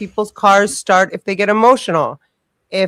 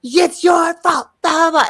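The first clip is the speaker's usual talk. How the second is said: mock freak-out; 10 semitones higher than usual. Acting out the woman blaming her, mock freak-out. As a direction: imitate a screeching, hysterical driver. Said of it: "she was freaking the f".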